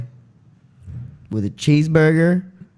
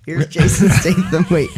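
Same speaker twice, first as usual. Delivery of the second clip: ordinary talk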